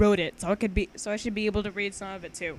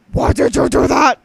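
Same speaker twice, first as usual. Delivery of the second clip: loud, higher puppet whine; dynamic puppet voice; 4 semitones higher, 13 dB louder than usual. Acted. Hand puppet protests being pummeled, loud dynamic voice. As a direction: cry out as the hurt hand. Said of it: "in an extremely dynamic voice".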